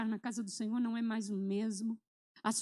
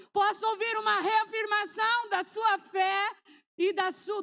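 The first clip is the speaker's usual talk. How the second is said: raised, ringing call for an amen; fervent preaching; 6 semitones higher, 4 dB louder than usual. Acted. Preacher rousing the congregation, fervent call. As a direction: preach it at full force to the congregation.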